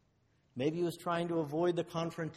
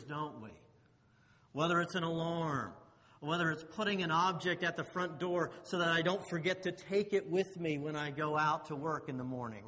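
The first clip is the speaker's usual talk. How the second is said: normal talking voice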